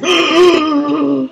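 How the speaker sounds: imitating a delighted gasp, high; a playful copied gasp of delight; mock gasp. Mimicking cohost's excitement over heart, high gasp. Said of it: "while she literally goes".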